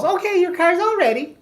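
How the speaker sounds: very high, put-on receptionist voice; mock receptionist. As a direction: imitate her chirping down the phone. Imitates receptionist's cheery call.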